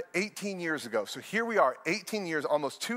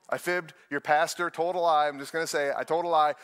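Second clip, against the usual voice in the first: ordinary delivery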